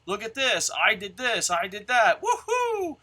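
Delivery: laughing, gleeful showing off; mock bragging. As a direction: brag it up with a laugh. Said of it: "if I'm like".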